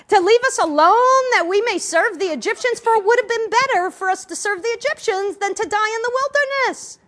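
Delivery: loud, high put-on accent of the complaining Israelites; mock accent. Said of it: "they said in the accent".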